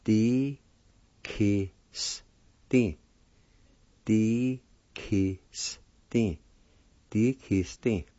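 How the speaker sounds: D-K-S-T said three times, crisp; pronunciation demo. Shown as a demonstration. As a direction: repeat D-K-S-T carefully. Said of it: "it's pronounced like this".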